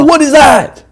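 high panicked shout; panicked shriek. Real, not acted. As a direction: shriek in sudden fright. Monster appears.

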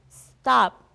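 sharp, loud shout of alarm; very loud yell. A narrator's reading. Taya yelling at the man who picked her up, very loudly. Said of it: "Taya yelled very loudly".

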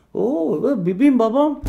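high flustered babble; flustered surprise. Retold or imitated. Waiter caught out by the German lady, sputtering high. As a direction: sputter in mock panic.

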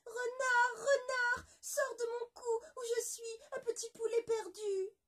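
very high, loud frightened call; crying out for help. Acted. La moitié de poulet appelle le renard au secours, criant. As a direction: scream for the fox to save her. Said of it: "cria de toutes ses forces".

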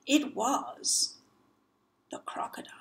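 whispered to the listener; hushed reveal. A narrator's reading. Teller whispering to child about crocodile. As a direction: breathe the secret out.

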